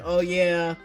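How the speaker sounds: ordinary delivery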